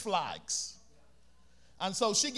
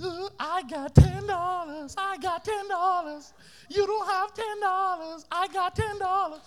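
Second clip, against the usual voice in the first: singsong boast; 8 semitones higher than usual. Retold or imitated. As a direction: taunt the friends with it. Boy bragging, singsong.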